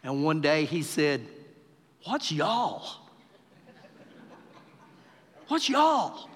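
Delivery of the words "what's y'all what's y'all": lightly imitated Hebrew accent; imitated Hebrew guide. Hebrew guide asks Texan tourists about a word, imitated accent. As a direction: puzzle over it like a Hebrew-speaking guide. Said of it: "our guide who was Hebrew".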